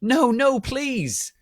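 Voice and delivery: high pleading no, no; high desperate plea, mock terror; begging. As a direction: beg for mercy, voice shooting up. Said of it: "we begged, sadly".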